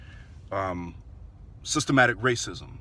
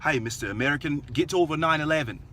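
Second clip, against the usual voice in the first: put-on British accent that slips into Australian, raised at the end; botched British accent; 4 semitones higher than usual. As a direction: attempt a British guy, landing nearer Australian. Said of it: "That was more Australian".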